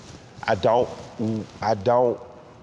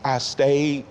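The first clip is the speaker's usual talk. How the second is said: ordinary talk, nothing marked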